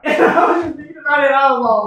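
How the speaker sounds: high, loud, laughing outburst; cracking up. Real, not acted. Storyteller losing it after their own cartoon impression, loud high laughter. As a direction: shout it through real laughter, pitch shooting up.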